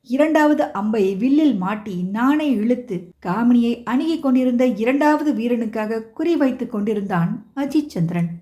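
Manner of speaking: ordinary delivery, nothing marked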